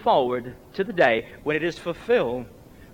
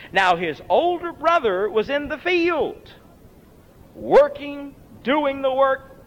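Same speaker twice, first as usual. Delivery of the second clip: raised preaching; 8 semitones higher than usual. Acted. Preacher quoting scripture, raised voice.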